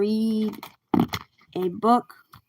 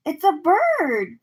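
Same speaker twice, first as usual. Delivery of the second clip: "It's a bird!" cried out higher, louder and slow; excited cry; 9 semitones higher, 5 dB louder, slower than usual. A narrator's reading. Miguel at the station, crying out excitedly. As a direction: call it out with delight. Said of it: "cried Miguel".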